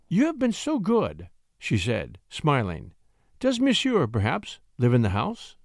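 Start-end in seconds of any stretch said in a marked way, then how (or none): 0.1-1.3 higher, sweet flattering lady; flirtatious lady. Acted. Lady thanking the poet, higher and coy. 3.4-5.6 fish for his address sweetly; coy lady. Acted. Lady asking the poet where he lives, coy.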